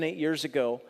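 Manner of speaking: ordinary talk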